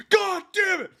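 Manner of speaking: very high, loud mock outburst; hammed-up furious yell; exasperated shout. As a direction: yell it like someone rudely woken. Startled brother wakes up, retold.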